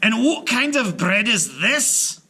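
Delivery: high loud gruff giant complaint; indignant giant. Acted. Giant complaining to Una about bread, indignant. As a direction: demand it like an outraged giant.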